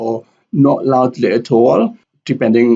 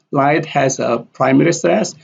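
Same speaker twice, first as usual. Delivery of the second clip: ordinary talk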